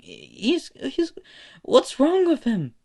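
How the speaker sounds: Melissa's father stammering at Izuku's antics, high and baffled; baffled squeak. Acted. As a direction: let the voice crack up in disbelief.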